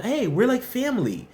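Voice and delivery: lightly voiced chummy employer, pitched high; imitating an over-friendly company; mock company cheer. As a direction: put on a high, welcoming corporate pitch.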